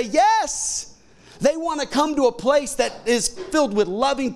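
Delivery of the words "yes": higher, bright yes; happy yes. Retold or imitated. Invited friend accepts the church invitation, high and glad. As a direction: answer as if delighted to be asked. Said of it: "people will say".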